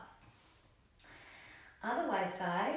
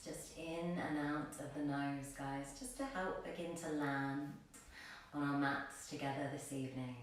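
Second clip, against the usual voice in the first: soft, low meditation voice with long pauses; guided meditation; 4 semitones lower, 5 dB quieter than usual. Acted. Yoga teacher guides class into grounding breaths, soft meditation voice. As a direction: settle them gently into the breath, hushed and unhurried.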